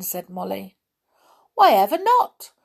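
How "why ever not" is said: high, loud, cheeky chirp; merry little voice. A narrator's reading. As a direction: pipe up merrily. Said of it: "said a merry little voice".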